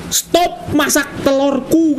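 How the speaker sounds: slow, high, stretched-out reading; reading out a message. Real, not acted. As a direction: spell out the chicken's message word by word. Ini kayaknya pesan si ayam.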